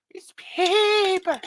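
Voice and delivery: high, slow mock-wailing "paper"; Waterworld paper reprise. Host repeats the Waterworld bit, high slow wail. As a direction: stretch out "It's paper" in a high strained wail. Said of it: "That was for all the nineties kids".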